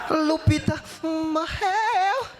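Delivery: slow, drawn-out Lupita morreu, hammed-up grief; mock tragic. Calling the boss to announce the dog's death, slow and hammed-up. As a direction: stretch the news out like a tragedy.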